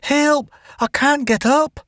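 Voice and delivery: high panicked cry; can't get up, scream. A narrator's reading. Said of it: "Jane screamed".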